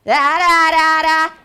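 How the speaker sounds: loud, high droning read-aloud. Shown as a demonstration. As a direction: blare it out like mechanical reading. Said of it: "this is her reading voice".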